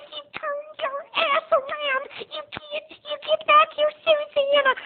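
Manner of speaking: very high, slow, urgent calling; frantic mother. Retold or imitated. Mother urging her daughter to turn around, high and frantic. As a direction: call her back without being heard.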